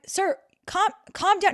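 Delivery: high-pitched appeal to calm down; retold plea. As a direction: cut in, pitched well above normal. Retold plea to angry man.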